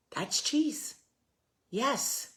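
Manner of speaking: hoog, toonloos gefluisterd als een gretige rat; sneaky rat whisper. Acted. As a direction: fluister het als een rat die kaas ruikt. Rats sniffing out the cheese.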